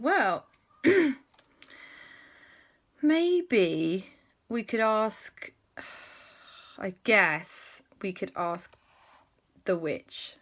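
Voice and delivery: slow, hesitant, reluctant suggestion; reluctant hesitation. Acted. Frog to the children, a slow reluctant suggestion. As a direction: drag it out, unwilling to say it.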